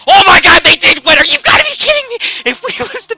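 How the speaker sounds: very high, loud disbelieving yell; high, loud stunned outcry; shocked shout. Real, not acted. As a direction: shriek in disbelief at the news. Penguins advance, rival matchup.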